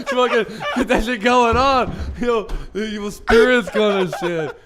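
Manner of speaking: loud laughing rant; laughing loudly. Real, not acted. Laughing loudly to his co-host about evil spirits. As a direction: shout it through laughter.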